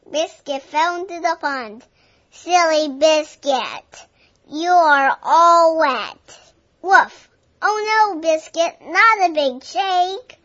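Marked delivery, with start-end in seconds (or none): 2.3-6.4 louder, slower teasing call; mock scolding, loud and slow; playful scolding. Dog fell into pond. 6.8-7.3 one loud sharp bark; loud puppy woof; loud dog bark. Acted. Dog barking in story. 7.6-10.4 higher, louder mock alarm; hammed-up oh no; playful alarm. Wet dog about to shake.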